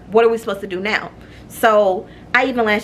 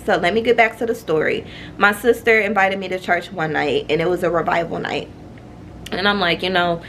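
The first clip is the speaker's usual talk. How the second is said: ordinary delivery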